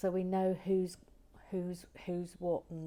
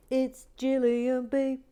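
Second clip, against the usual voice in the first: high, loud, slow grand reveal; theatrical name reveal, higher and louder; grand announcement; 7 semitones higher, 8 dB louder, slower than usual. Acted. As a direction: announce the name like a game show host.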